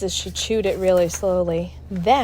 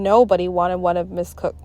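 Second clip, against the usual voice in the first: plain reading voice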